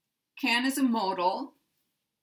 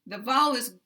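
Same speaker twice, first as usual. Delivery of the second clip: usual speaking voice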